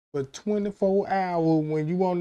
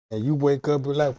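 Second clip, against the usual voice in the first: ordinary delivery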